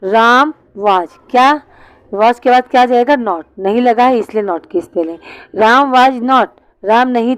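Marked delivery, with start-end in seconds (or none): 0.0-1.1 बहुत धीरे, खींचकर; राम was को लिखवाने की तरह; slow dictation. Shown as a demonstration. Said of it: "लिखेंगे was". (लिखते हुए बच्चों के लिए धीमा उच्चारण)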